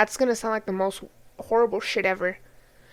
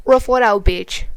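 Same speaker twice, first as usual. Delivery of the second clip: louder, blunt imitation of tough advice; mock dismissive; 8 dB louder than usual. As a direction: throw it out hard as a mocking quote. Mocking older generations' dismissive advice.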